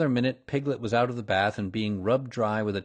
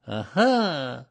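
higher, louder small-animal aha; raised, hopeful little aha; anxious attempt at triumph; 4 semitones higher, 5 dB louder than usual. A narrator's reading. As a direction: try an aha while uneasy. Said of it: "looking around anxiously for the others".

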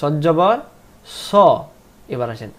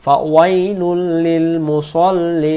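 ফাওয়াইলুল held long, louder; slow recitation; 2 semitones higher, 7 dB louder, much slower than usual. Shown as a demonstration. Teacher repeating the verse for students to follow. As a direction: chant the verse slowly, twice. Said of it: "আবার".

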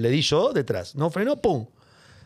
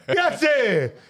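excited recognition; 7 semitones higher, much slower than usual. Retold or imitated. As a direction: greet a famous face with delight. Driver recognizing the celebrity.

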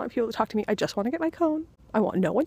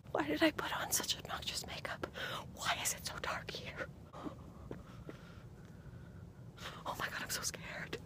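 scared hushed whisper; breathless nighttime whisper; 7 semitones higher, 15 dB quieter than usual. Real, not acted. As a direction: whisper so nobody outside hears. Whispering in the dark street.

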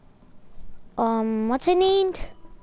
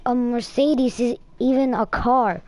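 normal talking voice

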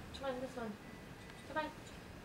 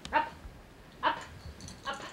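ordinary talk, nothing marked

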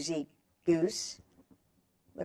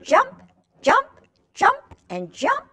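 bouncy jumping voice; 12 semitones higher, 4 dB louder than usual. Acted. Acting out kangaroo jumping.

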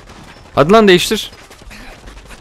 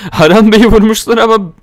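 high, loud words through laughter; giggling disbelief; laughing; 8 semitones higher, 4 dB louder than usual. Real, not acted. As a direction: crack up while speaking. Laughing at dead companion.

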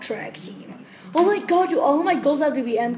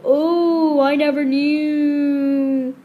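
ordinary talk

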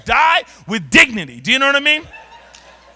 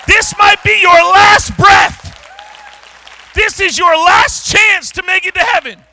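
frantic preaching; 9 semitones higher, 7 dB louder than usual. Retold or imitated. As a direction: preach like the plane is going down. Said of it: "a little frantic preaching".

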